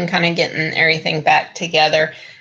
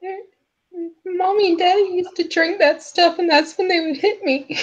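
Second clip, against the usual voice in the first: put-on high, small child voice; high-pitched girl confiding a hurt; tearful little girl; 11 semitones higher than usual. Rescued girl explains her fear to her rescuer, tearful child voice. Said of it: "a little tear in her eye".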